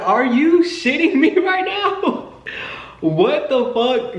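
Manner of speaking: very high, slow, laughing disbelief; shocked disbelief. Retold or imitated. She can't believe the locked door just opened, squealing in disbelief. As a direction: stretch it out in stunned laughing shock. Door opens despite lock.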